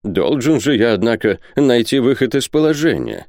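usual speaking voice

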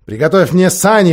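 loud, imperious queen's order; commanding. A narrator's reading. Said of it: "приказала колдунья".

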